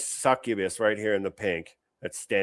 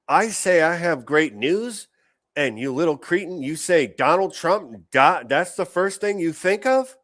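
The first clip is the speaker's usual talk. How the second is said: higher, louder sarcastic parent voice; mocking the mom; 8 semitones higher, 3 dB louder than usual. Host mocks the mom's quiz, in a higher scornful voice. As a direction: mimic the mother with scorn.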